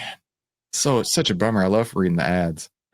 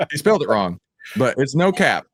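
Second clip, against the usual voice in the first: ordinary talk, nothing marked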